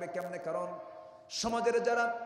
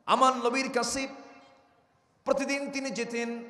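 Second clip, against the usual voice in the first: high, loud fiery preaching; preaching at full force; 7 semitones higher, 6 dB louder than usual. Acted. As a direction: thunder it to the crowd. Fiery sermon delivery.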